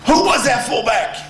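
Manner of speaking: high, loud coach shout; Bo impersonation. Retold or imitated. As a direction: yell it again as the angry coach. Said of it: "That's my best Bo impersonation".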